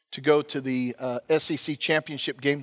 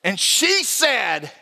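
normal talking voice

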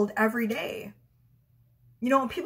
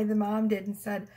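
ordinary talk, nothing marked

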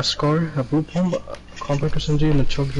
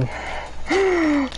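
sharp high gasp. Real, not acted. Sudden reaction in game.

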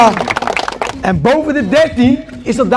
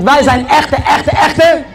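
rising shout of echte, echte; shouted catchphrase; 5 semitones higher, much slower than usual. Acted. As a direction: belt out the channel slogan. Hype outro catchphrase shouted.

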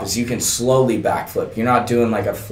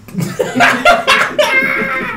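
high helpless laugh; burst of laughter. Real, not acted. Host cracking up at his own story, high helpless laugh.

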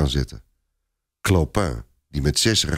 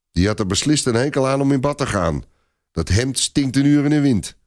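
quick, higher mocking jibe; mocking soldier; 7 semitones higher than usual. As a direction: sneer at the dead man's smell. Soldier mocks the dead knight.

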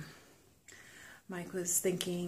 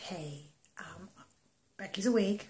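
ordinary talk

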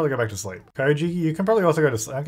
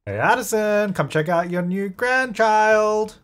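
high, drawn-out sing-song invitation; calling out cheerfully; 6 semitones higher, slower than usual. Pretend. Player calls a grandparent over to meet the baby, high and drawn out. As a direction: call them over with stretched-out delight.

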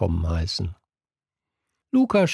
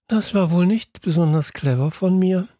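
higher-pitched, mildly sheepish admission; understated meek confession, pitched up; 9 semitones higher than usual. A narrator's reading. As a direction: own up, a little abashed. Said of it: "sagte ich kleinlaut".

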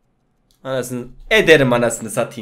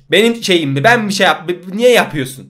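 mock indignant outburst; 7 semitones higher, 10 dB louder than usual. Mock arguing with wife.

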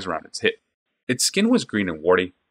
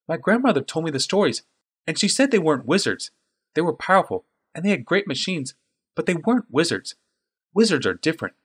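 put-on higher, earnest boy insisting; a keen lad correcting his elder; young boy voice; 9 semitones higher than usual. A narrator's reading.

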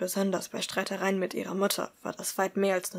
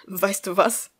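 growled; 6 dB louder, slower than usual. Acted. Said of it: "knurrte Tuttle".

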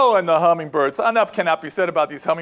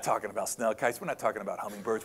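hushed build-up; 9 semitones lower, 11 dB quieter than usual. Acted. Building suspense before harpy eagle.

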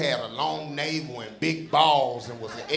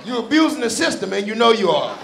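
ordinary talk, nothing marked

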